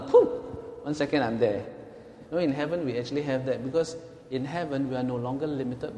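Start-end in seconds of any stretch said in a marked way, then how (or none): 0.0-0.4 high, loud "pooh" of instant travel; a sudden playful high-pitched whoosh; whoosh noise. Pretend. Joking to congregation about mind travel, sudden whoosh noise.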